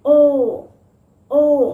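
ओ long and loud, amazed; oh of wonder; surprised oh. Shown as a demonstration. Teacher doing the oh action for the class. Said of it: "action भी यही हो जाएगा आपका".

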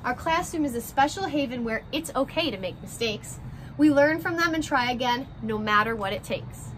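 gentle picture-book read-aloud, rhymes bouncing along; reading to children. A narrator's reading. Teacher reading a class picture book to her first graders, story-time voice.

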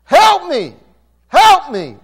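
loud, high-pitched desperate shout; crying out for help. Shown as a demonstration. Preacher shows a congregation what crying out is, shouted plea. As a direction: cry out at full force for rescue. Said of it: "to cry out like".

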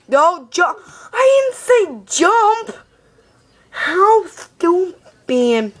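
slow, high exasperated scolding. Real, not acted. Boy scolding his game character for jumping, slow high exasperation.

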